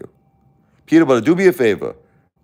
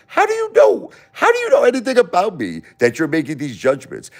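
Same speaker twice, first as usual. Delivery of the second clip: high-pitched, incredulous squawk, then settling; indignant outburst; 10 semitones higher than usual. Real, not acted. Indignant at being judged by viewer.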